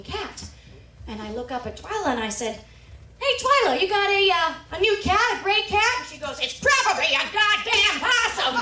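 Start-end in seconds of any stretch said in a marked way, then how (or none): 3.2-6.0 high, loud call across a barn; raised, high-pitched casual shout. Retold or imitated. Calling across the barn to grandma. 6.4-8.5 loud, high retort hollered back; mock outraged yell; imitated grandma yelling. Grandma yelling back about possum.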